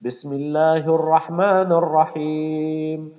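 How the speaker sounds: ordinary talk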